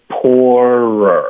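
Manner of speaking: "poorer" drawn out slowly with both r sounds; demonstrating poorer. Shown as a demonstration. Teacher answers a student's pronunciation question, slow demonstration of "poorer". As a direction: stretch "poorer" into poor plus a second r syllable. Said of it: "那这个就是这么发的".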